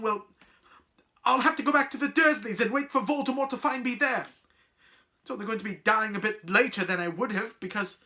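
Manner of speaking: high, loud, fast tirade; impassioned shouting. Acted. Boy convincing friends of the danger, heated shouting. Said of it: "Harry shouted".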